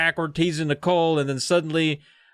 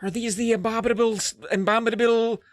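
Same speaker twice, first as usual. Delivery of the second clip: higher-pitched, mock-frightened questioning; fearful awe; 8 semitones higher than usual. Nicole sees giant footprints and fears a monster, mock-frightened wonder. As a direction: play a scared, gullible girl lightly. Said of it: "that in her fear".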